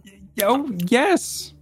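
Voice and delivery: put-on very high, hesitant young lady. Young lady answering the visitor below, high-voiced. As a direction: answer in a high, girlish character voice. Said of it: "one of the young ladies rises up".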